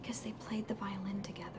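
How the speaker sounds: whispering. Acted. Doctor recalls grieving father.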